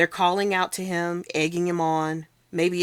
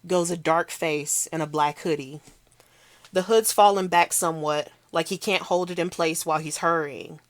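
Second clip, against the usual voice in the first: usual speaking voice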